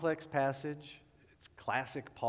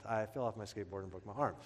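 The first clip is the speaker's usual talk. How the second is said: sheepish mumble; 6 semitones lower, 8 dB quieter, faster than usual. Retold or imitated. Sheepish aside.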